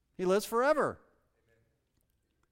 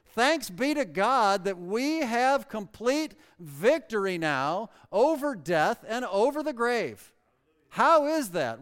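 slow, louder, higher preacher's proclamation; preaching at full force; 5 semitones higher, 7 dB louder, slower than usual. Acted. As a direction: proclaim the victory to the congregation. Preaching climax.

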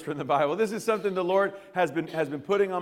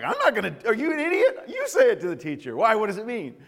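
higher, laughing, incredulous; laughing disbelief; 5 semitones higher, 3 dB louder, faster than usual. Retold or imitated. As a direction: laugh at the stupid dare. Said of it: "And I'm like".

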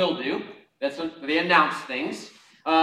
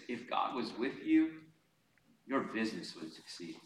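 lower, softer voice of the accuser; enemy's quiet insinuation; 6 semitones lower, 10 dB quieter than usual. Acted. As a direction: plant doubt softly, trailing down low. Voices the enemy's discouraging taunt.

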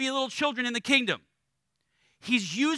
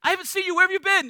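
much higher, bouncy voice of an overjoyed dog; excited puppy; 10 semitones higher, 6 dB louder, much faster than usual. Retold or imitated. Pastor voicing an excited puppy greeting its owner, high and gushing. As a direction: gush like a puppy whose owner just walked in. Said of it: "They're like".

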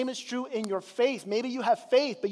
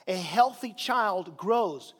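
slow, emphatic statement; 2 semitones lower, much slower than usual. Real, not acted. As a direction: draw it out slowly. Preacher stresses key point.

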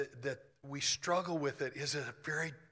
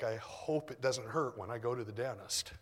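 ordinary talk

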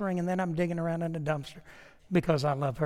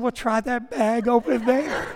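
high, slow quote broken by laughter; laughing while imitating; 5 semitones higher, 5 dB louder, slower than usual. Retelling the wife's advice at the dumpster, laughing. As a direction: imitate the crying wife through a laugh. Crying wife in dumpster story.

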